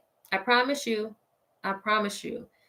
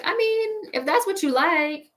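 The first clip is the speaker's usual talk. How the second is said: high-pitched, noncommittal mock-polite reply; feigned politeness; 9 semitones higher than usual. Woman hedging to a friend she won't lie to, feigned politeness. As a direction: lift it high, politely dodging a compliment. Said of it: "I'll be like".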